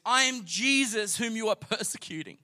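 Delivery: mildly elevated divine declaration; declaring as Jesus. Acted. Acts Jesus answering Saul.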